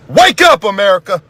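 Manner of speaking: urgent wake-up call to America. Real, not acted. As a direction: shout it to the whole country. Closing call to the country.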